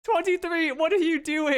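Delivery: very high-pitched calling out; mock alarm. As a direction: squeal it in playful disbelief. Player calls out in mock alarm.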